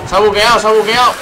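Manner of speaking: high, loud outraged shout; frustrated shout. Real, not acted. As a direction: yell at the broken game. Game bugged, player yells.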